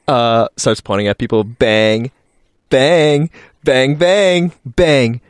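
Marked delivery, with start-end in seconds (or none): 1.4-5.2 loud, high shouted bangs with gaps; mock gunshots. Character points gun at people, loud playful shouts. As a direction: fire each word like a pretend shot.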